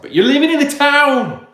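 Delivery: loud, high exasperated retort; exasperated outburst. Real, not acted. Telling off townies who complain, loud exasperated retort. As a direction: throw the punchline back at them.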